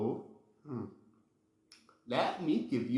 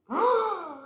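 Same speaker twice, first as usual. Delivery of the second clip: high excited gasp; 4 dB louder than usual. Pretend. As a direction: gasp in delight. Excited discovery for toddlers.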